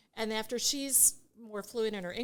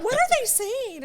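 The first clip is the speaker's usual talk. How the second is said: alarmed thought; 11 dB louder than usual. Retold or imitated. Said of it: "and I thought".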